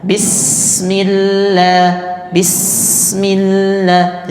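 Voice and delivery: slow chanted "Bismillah" with flowing S; drawn-out recitation. Shown as a demonstration. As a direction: let the S in "Bismillah" run long. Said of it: "jadi jangan ditahan-tahan". ("Bismillah" pelan dengan S mengalir)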